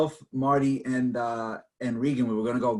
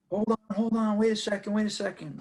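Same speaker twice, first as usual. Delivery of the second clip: officious steward; 9 semitones higher than usual. Retold or imitated. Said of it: "some guy jumps out of the".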